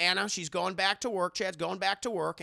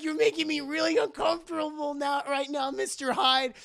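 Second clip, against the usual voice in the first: uneasy high protest; 10 semitones higher, 4 dB louder than usual. Acted. Anna frightened by her boss.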